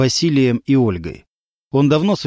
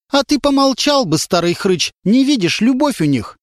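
put-on a feisty woman telling off an old man; stern rebuke; 9 semitones higher than usual. A narrator's reading. Woman scolds the old man in the cap, sternly. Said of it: "строго сказала".

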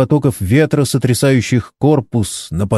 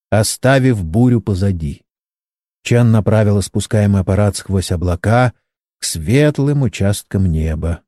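ordinary delivery

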